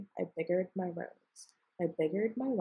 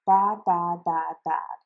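louder mock scolding bad, bad; mocking nag; 9 dB louder, slower than usual. Onceler mimicking the Lorax's scolding, louder mock nag. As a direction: parrot the nagging Bad, bad. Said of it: "yappity yap and say".

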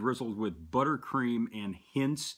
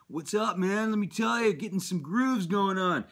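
put-on much higher, louder California Valley Boy surfer dude; 10 semitones higher, 4 dB louder than usual. Vaper pictures himself catching waves at the beach, stoked California surfer dude voice.

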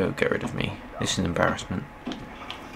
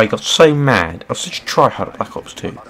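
ordinary talk, nothing marked

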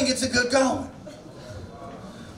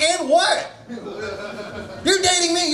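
laughing retort; 5 semitones higher, much slower than usual. Retold or imitated. Mocking girlfriend in retold quote.

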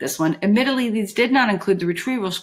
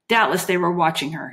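ordinary delivery, nothing marked

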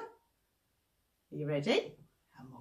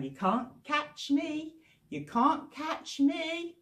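slow sing-song taunt, a bit higher; teasing monkeys; 7 semitones higher, slower than usual. Acted. Monkeys teasing Mister Crocodile, sing-song taunt. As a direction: tease like a cheeky monkey.

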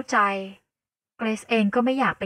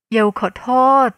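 urgent apology; 2 semitones higher, 8 dB louder than usual. Acted. Yale grabbing her hand, apologizing.

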